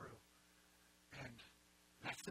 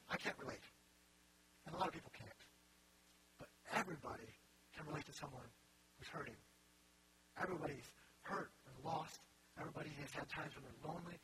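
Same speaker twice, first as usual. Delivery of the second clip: ordinary delivery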